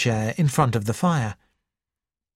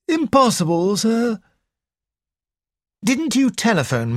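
polite, puzzled butler, a touch higher; a hint of a bewildered servant; lightly voiced butler; 5 semitones higher than usual. A narrator's reading. Narrator voices butler Parker.

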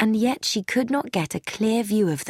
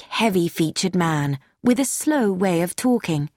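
plain reading voice

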